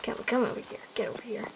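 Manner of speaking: urgent whisper. Acted. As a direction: whisper them over.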